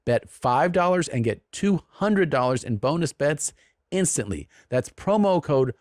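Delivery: upbeat sponsor pitch; ad read. Acted. Host reading the DraftKings sponsor spot in ad voice. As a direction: sell it like a sports promo.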